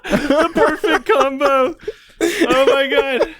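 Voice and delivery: slow words through laughter; laughing. Real, not acted. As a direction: laugh it out slowly. Laughing at the Madame Web pairing.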